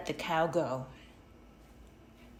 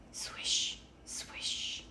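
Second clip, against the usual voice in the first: soft breathy swish; hushed swish; swishing leaves; 7 dB quieter than usual. A narrator's reading. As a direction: whisper the sound of falling leaves. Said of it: "The leaves fell on the roof".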